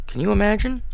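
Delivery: high, fussing motherly voice; fussy mom fretting aloud. Acted.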